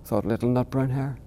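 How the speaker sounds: put-on gentle read-aloud for children; storybook reading. A narrator's reading. Author reading his bedtime book aloud to interviewer, gentle storytelling.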